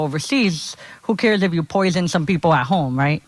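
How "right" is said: usual speaking voice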